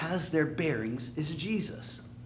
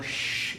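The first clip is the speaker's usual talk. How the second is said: shushing. Acted. Trying to calm an angry sea, shushing. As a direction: hush it like a fussing child.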